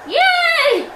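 loud, high-pitched cheer; gleeful cheer. Real, not acted. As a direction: let out a big celebratory whoop. Cheering during the game.

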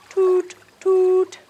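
high, loud toot toot; train toot. Pretend. Leader tooting a train horn for kids, high. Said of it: "We pull the horn".

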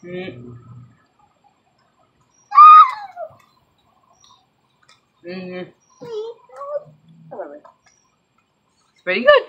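talking while chewing. Real, not acted. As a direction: mouth partly full of food. Seen 0: After bite at -5, cheeks bulge, lips pressed chewing through 4.8.

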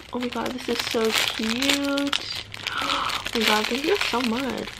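ordinary delivery

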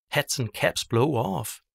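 ordinary delivery, nothing marked

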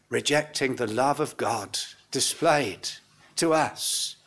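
usual speaking voice

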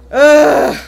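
loud scared ugh; loud frightened groan. Real, not acted. Reacting to a jump scare.

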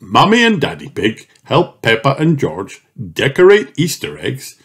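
ordinary delivery, nothing marked

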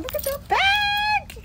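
excited shout. Real, not acted. Seeing the back of the store, an excited shout. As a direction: call out in delight.

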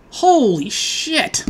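very slow, drawn-out shock; stunned. Real, not acted. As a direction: let the shock sink in. Shocked at the draw.

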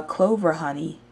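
usual speaking voice

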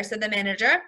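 ordinary delivery

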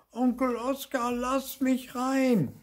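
put-on very high, drawn-out childlike wail; bellowing. A narrator's reading. Tiny ghost begs Onkel Oskar, bellowing. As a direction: call slowly and very high to be let in. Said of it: "brüllte er".

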